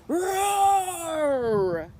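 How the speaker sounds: loud, high truck roar. A narrator's reading. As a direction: let the bulldozer bellow. Bulldozer thunderous sound.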